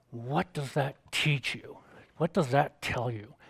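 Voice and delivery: hint of a theatrical lecturer, drawn out; dramatic professor. Professor questioning his silent class, lightly dramatic. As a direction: pose it slowly and weightily, lightly imitated teacher. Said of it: "had an interesting way of speaking".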